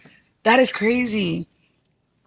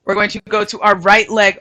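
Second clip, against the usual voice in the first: ordinary talk, nothing marked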